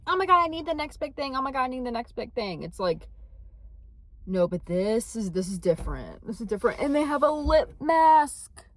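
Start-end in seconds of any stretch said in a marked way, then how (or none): 0.1-2.6 put-on higher gushing hype-girl voice, then settling; mock hype girl. Mocking the trend-chasers she's not like, gushing. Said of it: "I'm not someone that's like". 6.8-8.7 higher, louder and slow, savoring each word; gushing over the new lip mask; excited. Real, not acted. Telling viewers about the lip mask, thrilled.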